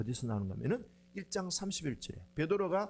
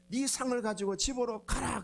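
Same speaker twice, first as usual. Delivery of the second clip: Jesus commanding; 7 semitones higher than usual. Acted. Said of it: "예수님이 뭐라 하는가 하면은".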